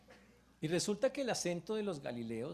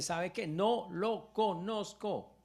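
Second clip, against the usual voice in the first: drawn-out higher, louder NO; emphatic denial; 5 semitones higher, 4 dB louder, much slower than usual. Acted. Peter snapping at the servant girl, emphatic denial. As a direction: flatly deny knowing him, slow and hard.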